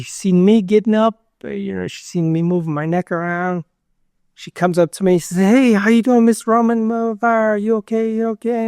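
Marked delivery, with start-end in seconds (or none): 5.4-8.7 lightly voiced nurse, pitched higher, checking in; a hint of a friendly nurse leaning over the bed; caring nurse. Nurse checking on a patient just waking from surgery, lightly voiced and higher.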